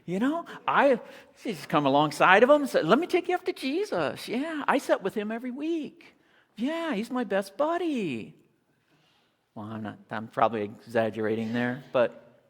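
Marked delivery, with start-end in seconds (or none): 0.0-8.3 much higher, sucking-up mock voice; fawning crowd. Preacher mocking the crowd now befriending the blind man, high fawning voice. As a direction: play the crowd's sudden fake friendliness. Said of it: "they're going".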